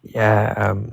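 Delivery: normal talking voice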